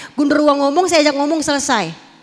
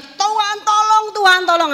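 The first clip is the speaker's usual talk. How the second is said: mild retold cry for help; 7 semitones higher than usual. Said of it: "Semua orang berteriak".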